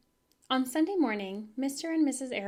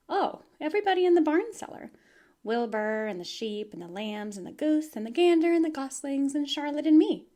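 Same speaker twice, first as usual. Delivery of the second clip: higher, louder eager rush of names; excited girl; 6 semitones higher, 9 dB louder than usual. Acted. Fern tells father about barn friends, excited and louder. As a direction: kid proudly listing all her friends.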